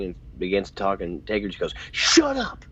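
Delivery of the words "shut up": sudden high, loud "shut up" yell; retold yell. Taker cutting off Triple H as he begins to talk, yelled. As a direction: yell it sharply, imitating an angry interruption. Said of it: "He just yells at him".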